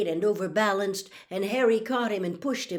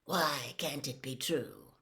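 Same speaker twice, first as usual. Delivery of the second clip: lower, softer, evenly calm question; 5 semitones lower, 5 dB quieter than usual. A narrator's reading. Lupin questioning Hermione as though in class, calm. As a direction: ask it calmly, low and soft. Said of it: "Lupin said calmly".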